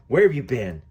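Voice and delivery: loud, angry demand. Acted. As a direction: bark it at her. Husband confronts her in anger.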